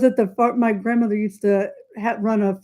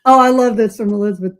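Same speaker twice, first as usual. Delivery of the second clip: usual speaking voice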